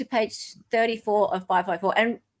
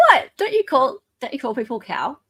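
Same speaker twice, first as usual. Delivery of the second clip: incredulous; 5 semitones higher, 4 dB louder than usual. Real, not acted. Amused protest.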